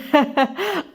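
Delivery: acted hearty chuckle; amused laugh, lightly performed. A narrator's reading. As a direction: laugh teasingly at her. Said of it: "سيف بضحكة عالية".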